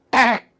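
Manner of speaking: high, sudden metal tick; imitated creak. Imitating the zinc roof popping, short high noise. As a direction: seng atap berbunyi karena suhu, tiruan bunyi singkat bernada tinggi.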